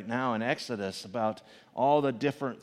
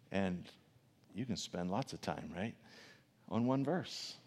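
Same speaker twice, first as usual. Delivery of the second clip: ordinary delivery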